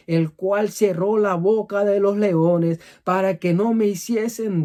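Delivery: fervent preaching. Acted. Pastor urging his brothers to trust God, fervent and loud. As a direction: build the sermon to a passionate peak.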